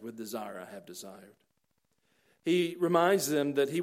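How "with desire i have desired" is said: much softer, tender With desire I have desired; hushed tender quote. Acted. Preacher voicing Jesus' longing to his friends, hushed. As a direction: say it almost under your breath, lovingly.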